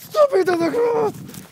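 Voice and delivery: very high, drawn-out screech; yelling at the dog. Real, not acted. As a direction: scream the order across at the dog. Yelling at dog eating grass.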